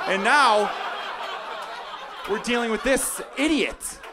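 hammed-up furious father, shouting; mock angry dad rant. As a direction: rant at the kid, half laughing. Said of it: "And I'm gonna be like".

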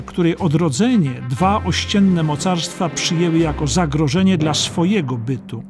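usual speaking voice

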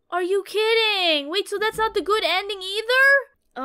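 high, incredulous protest; exasperated disbelief. Real, not acted. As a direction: protest high at the unfair outcome. Bad ending again.

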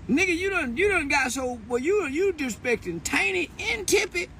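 high, squeaky mock outrage; mock outraged squeal. Scolding the boy for disrespecting Tiny and Tippy, high squeaky outrage. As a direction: squeal it like an indignant aunt.

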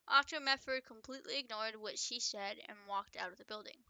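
plain reading voice

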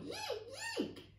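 very high monkey squeals; screaming monkeys. A narrator's reading. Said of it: "screamed the others".

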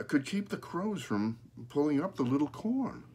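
usual speaking voice

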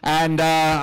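ordinary talk

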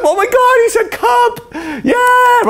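very high, squealing parent delight, slow on he said cup; thrilled parent. Retold or imitated. Parent hearing baby's first word, high squealing delight. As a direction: gush over the first word as if it's a miracle. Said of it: "I'm like".

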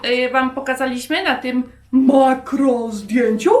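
ordinary delivery

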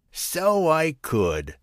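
high, very slow, stretched out; a hammed-up pleased tiger; drawn-out tiger agreement. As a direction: savour the idea slowly.

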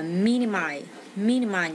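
"minimize" with its first syllable stressed. Shown as a demonstration. Teacher showing word stress to students, "minimize" stressed at the start. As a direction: lean on the opening MI of "minimize". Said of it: "nhấn âm đầu".

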